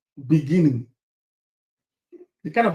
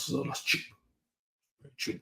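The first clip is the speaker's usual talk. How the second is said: hushed aside; 3 semitones lower, 13 dB quieter than usual. Acted. Acting out inspecting mansions.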